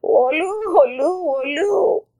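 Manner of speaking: high wailing storybook sobs; hammed-up high-pitched boo-hoo; mock crying. A narrator's reading. Rabbit locked out of her house, mock crying. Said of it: "began to cry".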